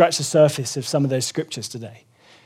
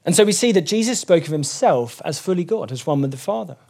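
ordinary talk, nothing marked

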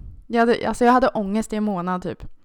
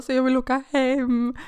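whiny plea; 5 semitones higher than usual. Retold or imitated. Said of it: "jag bara".

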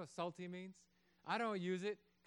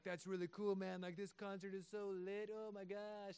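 lightly voiced teen, softer; a soft, gushing teenager; mild gushing kid impression; hint of a teen voice; 5 dB quieter than usual.

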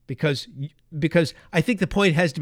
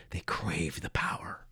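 whisper; 11 semitones lower, 11 dB quieter than usual. Acted. Dark aside about establishment power.

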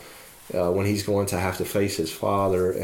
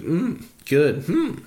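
lightly voiced Yoda, a high humming mm of relish; Yoda savoring food; 7 semitones higher, much slower than usual. Yoda tastes his cooking with a high hum. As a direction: hum over the food like a tiny old master. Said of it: "Yoda, uh, says".

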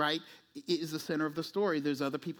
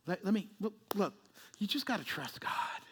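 pat church answer; 5 dB quieter than usual. Acted. Plays pat church answer to sufferer.

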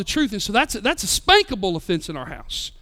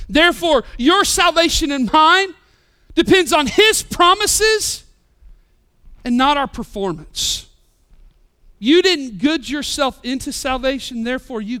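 preaching; 5 semitones higher, 5 dB louder than usual. Acted. Preaching at full force.

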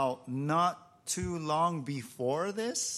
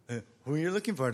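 quick, casual retelling; lightly imitated sheepish repeat; comic replay; much faster than usual. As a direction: toss the question off fast, amused. Comic replay after they got up.

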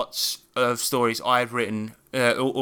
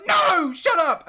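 put-on very high, stretched-out child's yell; mock annoyed shout; 4 dB louder, much slower than usual. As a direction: imitate an annoyed kid yelling. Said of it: "she got really annoyed".

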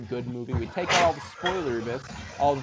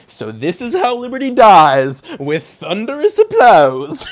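mocking quote; 7 semitones higher, 15 dB louder than usual. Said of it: "she's like".

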